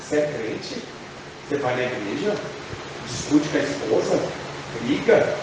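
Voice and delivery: low, soft accusing whisper of the devil; lightly voiced devil, low and quiet. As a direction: needle them like the accuser. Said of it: "o próprio diabo vem te acusar".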